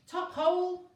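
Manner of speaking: put-on high, very loud jolly old-boy yell; hearty yell. A narrator's reading. Zeus yelling back to Elliot from Pegasus, jolly. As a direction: bellow it cheerily from horseback. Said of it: "Zeus yelled back".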